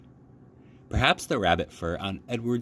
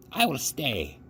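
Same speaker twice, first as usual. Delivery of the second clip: put-on old woman voice; 5 semitones higher than usual. A narrator's reading. Grandmother voice.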